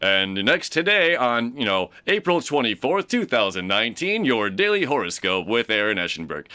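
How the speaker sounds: put-on radio announcer, projected; sweet radio voice. Imitating the homeless man on video announcing the daily horoscope, radio voice. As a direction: announce it like a radio host showing off the voice. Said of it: "sweet radio voice you got".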